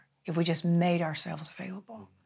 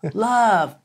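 loud, high burst of hope; hammed-up excited answer; eager guess; 6 semitones higher, 10 dB louder than usual. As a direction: blurt it like an over-keen pupil. Acting her next eager guess.

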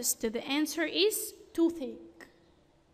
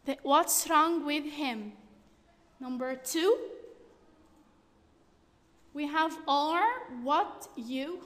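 ordinary delivery

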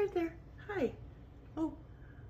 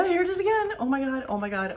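excited outburst; 8 dB louder than usual. Real, not acted. Woman spotting the squirrel return, real excited outburst.

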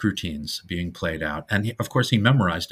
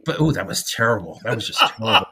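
after bitter medicine; faster than usual. Real, not acted. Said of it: "let me get the taste out of my mouth".

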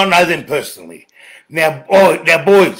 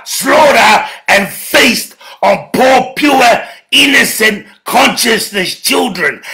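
furious shout; 6 semitones higher than usual. Real, not acted. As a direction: rage at them, every word hurled. Furious rant at his enemies.

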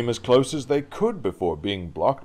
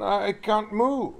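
high, slow, helpless protest; flustered stammer; 12 semitones higher, slower than usual. Acted. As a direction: drag it out helplessly. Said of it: "stammered Gordon".

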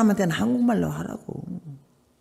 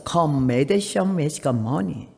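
very slow, deliberate broken English; 또박또박 느린 콩글리시; Korean-accented English again; 4 semitones lower, much slower than usual. Retold or imitated. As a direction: drag out each word like a proud old lady. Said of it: "또 했어".

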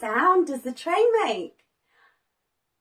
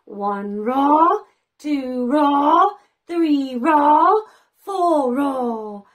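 louder roars, each rising at the end; counting roars; 8 dB louder, much slower than usual. Shown as a demonstration. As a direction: count and roar for the children. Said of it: "Can you help me roar to four?".

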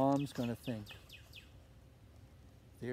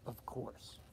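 ordinary delivery

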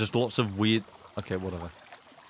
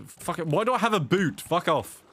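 exasperated; 7 semitones higher than usual. Real, not acted. Frustrated at game mishap.

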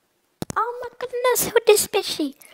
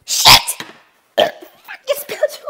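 startled curse; 12 semitones higher, 5 dB louder, much slower than usual. Real, not acted. Spilled water on keyboard.

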